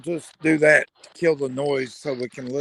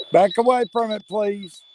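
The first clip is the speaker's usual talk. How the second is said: raised firm voice, higher pitch; firm command; 6 semitones higher, 4 dB louder than usual. Real, not acted. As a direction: order it back, loud and steady. Commanding a spirit to retreat.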